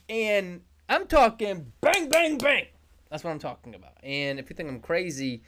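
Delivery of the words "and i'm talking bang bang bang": hyped up. Real, not acted. Hyping up his slides.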